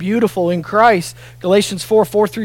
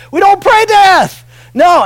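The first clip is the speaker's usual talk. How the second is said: loud, high, slow shout; 12 semitones higher, 8 dB louder than usual. Real, not acted. Pastor correcting death prayers to the congregation, shouting. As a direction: shout it out to the room.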